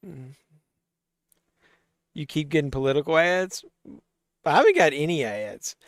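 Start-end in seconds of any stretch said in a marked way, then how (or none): none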